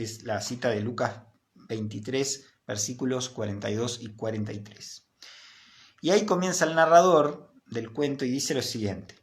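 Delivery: ordinary delivery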